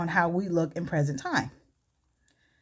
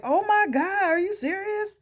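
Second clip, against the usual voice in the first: high, incredulous mock outcry; shocked crowd reaction; 11 semitones higher than usual. Everybody reacts to her stalking confession, shocked and high-pitched. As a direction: voice the stunned audience, pitched right up.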